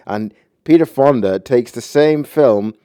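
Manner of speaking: normal talking voice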